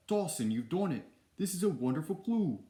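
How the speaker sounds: put-on high, loud, botched British accent turning Austrian; accent slipping into Schwarzenegger. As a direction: attempt British, drift into an excited Schwarzenegger accent. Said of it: "that turned into Schwarzenegger real quick".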